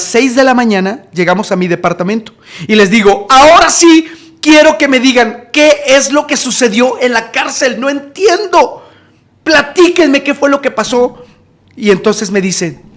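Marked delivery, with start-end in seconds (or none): 3.3-11.2 high, insistent questioning; exasperated demand. Retold or imitated. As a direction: demand an explanation in bewilderment. Retold demand for explanation from friends.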